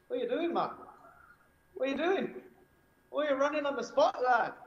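high-pitched, hammed-up attempt at a Newcastle accent; muddled mock Geordie accent. Retelling strangers in the sauna questioning him, high mock Geordie accent. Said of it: "What was that accent?".

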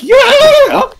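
very high, loud excited squeal; squealed yeah. Retold or imitated. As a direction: squeal it like Gage does. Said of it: "she says, like".

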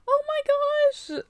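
high gushing with a gasp; real high-pitched excitement; delighted squeal. Real, not acted. Finds more cute figures.

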